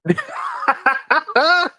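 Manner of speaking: bursts into high laughter; high-pitched amused laugh. Real, not acted. Laughing at joke.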